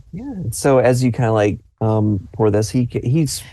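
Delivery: usual speaking voice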